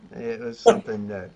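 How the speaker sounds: words rising high into a laugh. Real, not acted. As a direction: break into laughter mid-sentence. Laughing about the smoke disaster.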